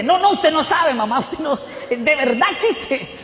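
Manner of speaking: almost shouting it, desperate to convince her; excited insistence. Retold or imitated. Son tells mother God exists.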